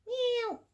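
high playful mock meow; cute cat sound aimed at the t-shirt.

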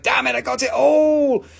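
playful mock yell at losing out; mock frustrated shout. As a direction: yell it like you just missed the deal. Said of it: "She's like".

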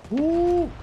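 high, loud "woo"; loud whoop. Real, not acted. Gamer after taking out a handful, whooping.

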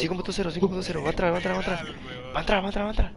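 excited. Real, not acted. Enemy about to enter.

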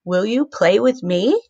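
"Will you play with me?" rising up on "me"; question tune. Shown as a demonstration. Teacher showing kids how a question sounds, rising. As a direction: ask it like a real question. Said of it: "Like you're asking a question".

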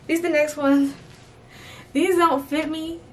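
ordinary talk

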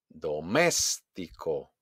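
slow, low "Doméstico", punched on MÉS; exaggerated second-syllable stress. Shown as a demonstration. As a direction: draw out "Doméstico", hitting MÉS. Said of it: "exagerar la pronunciación". ("Doméstico" lento, con golpe en MÉS)

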